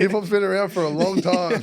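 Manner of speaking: amused, laughing as it comes out. Real, not acted. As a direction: say it through laughter. Joking with co-host, both laughing.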